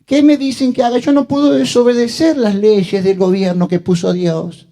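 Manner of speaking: voz de ovejita, muy aguda y alargada; quéjate como una ovejita perdida, en tono agudo; ovejita indefensa. Acted. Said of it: "¿Qué soy yo, la ovejita?". (vocecita de oveja)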